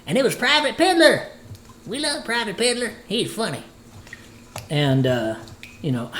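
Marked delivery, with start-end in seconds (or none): 0.1-3.6 very high, loud squeaky soldier voice; Private Piddler voice. Acted. Cook shows off the character he voiced, in a high loud squeak. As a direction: jump way up into a loud cartoon private. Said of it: "can I use this voice?".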